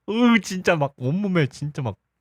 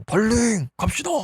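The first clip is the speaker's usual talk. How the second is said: a much higher, lilting mock call that fades off softly; sing-song call; 7 semitones higher, 3 dB quieter than usual. Jealous man luring his date away from the rival, high mock croon. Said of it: "갑자기 이렇게 얘기를 합니다".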